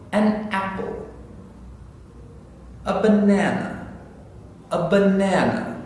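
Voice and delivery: put-on careful vocabulary drill for a small child; slow teaching model. Shown as a demonstration. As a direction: present each word slowly for repeating. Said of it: "slow it down".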